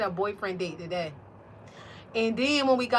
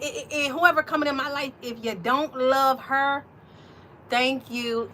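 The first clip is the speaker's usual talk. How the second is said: usual speaking voice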